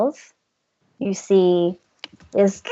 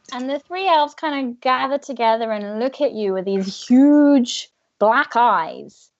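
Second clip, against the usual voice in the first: usual speaking voice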